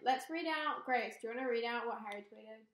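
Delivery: normal talking voice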